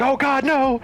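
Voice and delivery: high, loud cry of "oh God no"; retold dismay. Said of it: "And I'm like".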